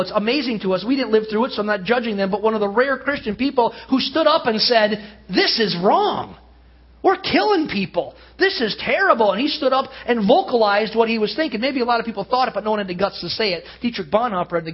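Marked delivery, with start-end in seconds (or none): 5.3-9.3 impassioned protest. Retold or imitated. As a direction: proclaim it like a man standing up against evil. Bonhoeffer's protest retold in sermon.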